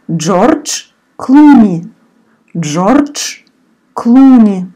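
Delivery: slow, clear read-through of the word list; word list read aloud. Shown as a demonstration. Teacher reading the lesson's word list for learners to repeat. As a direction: model each word twice for repeating.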